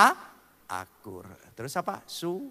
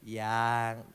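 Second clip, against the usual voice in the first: tender yang; 7 semitones lower than usual. Shown as a demonstration. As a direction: murmur "Yang" lovingly to your wife. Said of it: "Intonasinya itu penuh nada kasih".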